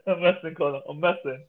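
ordinary talk

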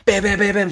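high, punchy bam bam bam; rope slam noises. Retold or imitated. Runner mimicking battle ropes with high bams.

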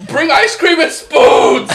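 loud, slow, laughing plea; laughing shout. Real, not acted. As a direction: yell it out through laughter. Pleading for relief, laughing shout.

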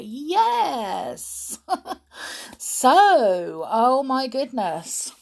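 ordinary delivery

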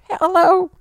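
very high pitched altered voice; voice changer. Pretend. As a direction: chipmunk voice changer effect. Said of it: "she uses the voice changer".

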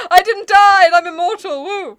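loud, high gleeful proclamation; playful hammed-up boast; mock triumph. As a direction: crow it out with a whoop. Plays Poe revealed alive.

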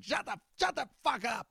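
high, strained grumpy grandpa snap; grumpy peepaw. Retold or imitated. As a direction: bark it like a fed-up grandpa. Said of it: "he's like".

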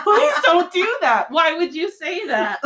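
high, slowed, laughing disbelief; exasperated plea. Retold or imitated. Mom pleading with husband not to offer candy, laughing exasperation. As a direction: beg him through a laugh to stop. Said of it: "I'm like".

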